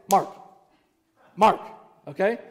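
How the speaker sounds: quick Mark. Shown as a demonstration. Pastor demonstrating the fast Mark motion, quick and sharp. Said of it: "So for Mark, it's".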